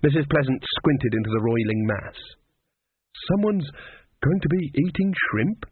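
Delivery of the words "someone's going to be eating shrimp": mildly helpful, higher in pitch. A narrator's reading. Cook reading the pot for the voodoo woman, helpful. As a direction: offer it helpfully in a raised voice. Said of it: "she said helpfully".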